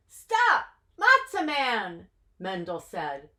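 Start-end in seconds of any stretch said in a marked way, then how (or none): 0.1-2.1 high loud call. Acted. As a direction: call out to stop him. Mendel calls out.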